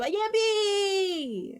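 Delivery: high, loud, slow "yippee"; mock cheer. Streamer consoling herself after losing, hammed-up cheer. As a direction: cheer yourself up, a bit hammed.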